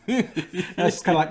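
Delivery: slow, high, laughing through words. Real, not acted. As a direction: laugh as the joke builds. Laughing while setting up joke.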